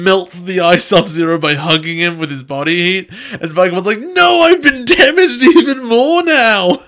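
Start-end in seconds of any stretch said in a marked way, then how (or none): 4.0-6.8 high, slow, loud wail; whiny villain. Retold or imitated. Villain lament in retelling.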